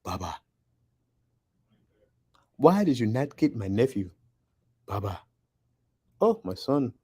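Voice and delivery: lightly attempted Wakandan African accent; a rough stab at an African accent. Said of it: "I do horrible accents".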